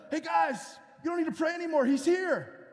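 high, loud, bursting with news; excited shout. Retold or imitated. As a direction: burst into the room yelling the news.

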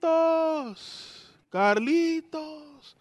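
high, long-drawn calling voice; parent calling child. Retold or imitated. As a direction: call a child home, stretching the name. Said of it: "¿qué le decían?".

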